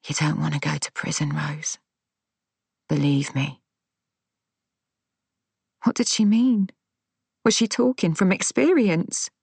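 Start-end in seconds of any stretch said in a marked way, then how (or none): 0.1-3.6 softer, fast hushed persuasion; soft whisper. A narrator's reading. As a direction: coax her under your breath. Daphne persuading in low whisper.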